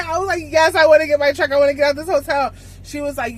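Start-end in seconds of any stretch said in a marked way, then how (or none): 0.5-2.5 louder, fast, high eager answer; eager yes. Retold or imitated. Trainee begs for her truck, loud, fast and eager. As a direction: blurt it out, desperate to leave.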